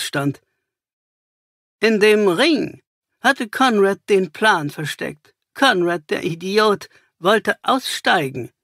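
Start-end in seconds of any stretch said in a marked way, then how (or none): 1.8-8.5 scorn the dead accomplice; cynical villain. Acted. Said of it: "kam es zynisch". (zynisch, höher und spöttisch)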